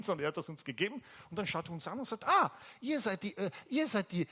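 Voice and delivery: usual speaking voice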